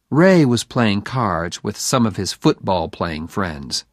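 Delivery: plain reading voice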